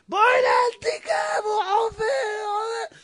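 high, stretched-out acted wail; panicked screaming. Wife watches husband wreck the oven, panicked screaming. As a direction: cry out in mock hysteria.

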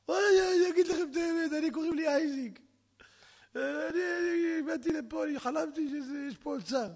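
high, whimpering captive voice; hammed-up scared little man; frightened confession. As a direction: plead it out in mock fear.

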